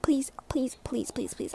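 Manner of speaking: ordinary talk, nothing marked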